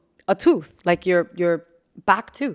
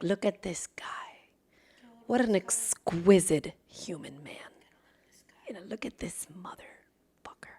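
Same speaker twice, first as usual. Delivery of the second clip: admiring whisper; 3 semitones lower, 13 dB quieter than usual. Retold or imitated. Imitating someone admiring a classy man, whispered. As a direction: whisper it as you eye someone up. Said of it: "Like that".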